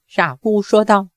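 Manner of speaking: usual speaking voice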